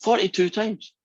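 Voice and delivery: high-pitched mock astonishment; incredulous repeat. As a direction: echo it high, as if scandalised. Incredulous repeat of the confessed number.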